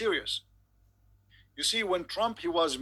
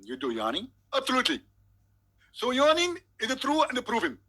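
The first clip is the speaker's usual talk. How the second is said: higher, louder confident preacher voice; Zakir Naik impression; 4 semitones higher, 4 dB louder than usual. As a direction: lecture with smug certainty. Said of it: "If we call Zakir Naik".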